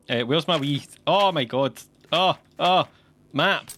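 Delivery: ordinary talk, nothing marked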